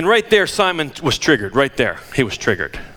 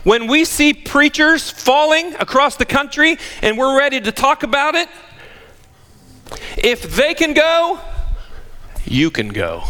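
rising, higher preaching appeal; 10 semitones higher than usual. Acted. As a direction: build the warning to the church. Preaches to congregation.

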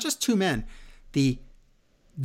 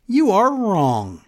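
slow, louder, punched ending; emphatic warning; 5 semitones higher, 6 dB louder, slower than usual. Real, not acted. Warning fans booking won't change, slow emphatic punch. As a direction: lean hard on every word.

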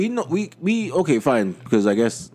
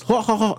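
high-pitched mock "ho ho ho" laugh; imitated signature laugh; 10 semitones higher than usual. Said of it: "That little sound bite that she does".

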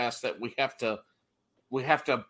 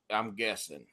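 ordinary delivery

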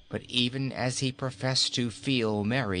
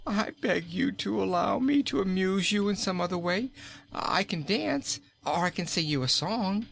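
pleading king; 10 semitones higher than usual. Acted. King begs queen to let him dance, high pleading. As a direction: plead to escape the tickling.

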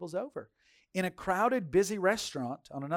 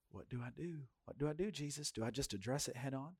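soft, retold plea to Jesus; silent prayer; 12 dB quieter than usual. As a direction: ask God under your breath what to do.

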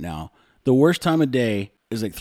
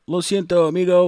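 a cheerful Spanish apology, higher and louder; Spanish phrase; 8 semitones higher, 6 dB louder than usual. Shown as a demonstration. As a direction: say it high and loud with Spanish vowels. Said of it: "which means".

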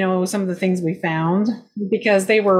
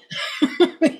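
laugh; 8 semitones higher than usual. Real, not acted. Laughs after ghost voice.

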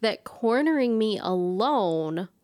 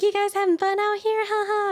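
high, bright-toned mom checking in; chirpy mom voice; 12 semitones higher, 4 dB louder than usual. Retold or imitated. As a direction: play the cheery mom.

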